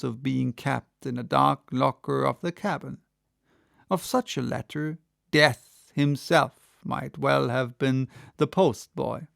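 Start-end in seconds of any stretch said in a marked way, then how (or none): none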